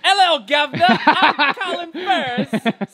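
a light mock British accent, drawn out. Showing a friend how the British accent is done, light mock British. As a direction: greet the governor in a hammed-up British accent. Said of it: "That's how you do the British accent".

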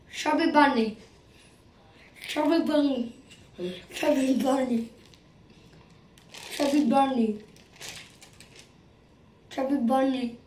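mumbled through a packed mouth; cheeks stuffed full. Real, not acted. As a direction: mouth crammed with marshmallows. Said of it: "Basically, I put this in".

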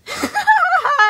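high hysterical cackle, almost crying; manic shrieking laugh. A narrator's reading. Sun cackling wildly at Gregory. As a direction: lose it in a crazed laugh.

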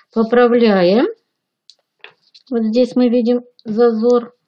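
ordinary talk

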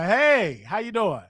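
ordinary talk

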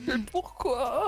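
slow, wailing mock cry; raging gamer. Real, not acted. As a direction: drag out the despairing question. His plan blew up. (joueur dégoûté qui se lamente lentement)

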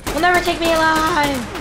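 hammed-up defiant yell; defiant cry. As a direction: shout it like a doomed hero.

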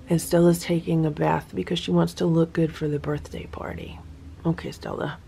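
soft unvoiced whisper about the cat; hushed aside to the listener. Real, not acted. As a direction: lean in and whisper it.

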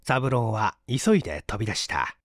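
usual speaking voice

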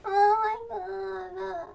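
high, loud, very slow moan; long, emotional drawn-out cry; overwhelmed wail. Real, not acted. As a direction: wail it out, stretching every word. Overwhelmed by character's return.

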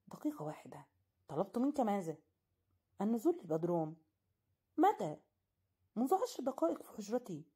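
disbelieving questions, pitch jumping up; astonished. Acted. Narrator confronting his host in loud disbelief. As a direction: demand answers in shock.

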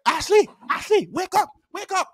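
high, repeated calls to a sleeper; urgent mock wake-up call; waking a pupil. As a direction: imitate a teacher rousing someone.

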